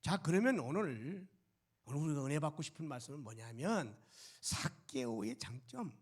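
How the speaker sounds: ordinary talk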